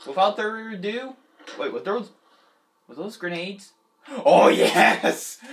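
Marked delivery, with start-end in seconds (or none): 4.2-5.4 loud, gleeful exclamation. Real, not acted. Player spotting grenades, gleefully loud. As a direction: burst out, thrilled at the find.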